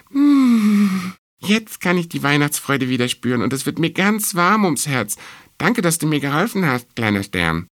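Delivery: high, delighted little mouse; mouse character. A narrator's reading. Mouse thanking the star for Christmas joy, high and delighted. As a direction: savour it with a long high hum, then thank happily.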